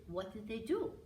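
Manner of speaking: ordinary delivery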